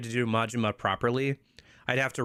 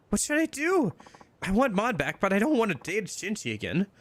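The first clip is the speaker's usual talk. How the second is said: put-on pleading young woman; 9 semitones higher than usual. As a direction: cry out what should I do, helpless. Heroine pleads.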